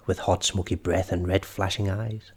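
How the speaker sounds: ordinary delivery, nothing marked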